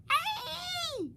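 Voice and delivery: very high loud shriek; high piercing yelp. Acted. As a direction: scream in fright. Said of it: "shrieked Flap".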